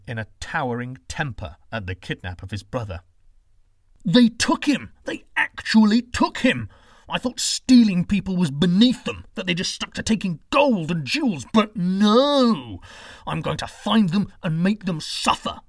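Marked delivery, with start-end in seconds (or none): 4.0-4.9 furious high shout; raging. Acted. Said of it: "Romulus raged". 5.0-6.7 raging disbelief. Acted. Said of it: "Romulus raged". 7.1-15.6 loud, high furious rant; raging. Acted. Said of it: "Romulus raged".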